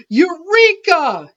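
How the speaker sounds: slow, high, loud triumphant shout. Acted. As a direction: cry it out like a discovery. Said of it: "he shouted".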